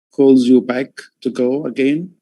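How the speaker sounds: normal talking voice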